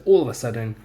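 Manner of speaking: dramatic story reading; dramatic dictation. Shown as a demonstration. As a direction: announce the sudden moment.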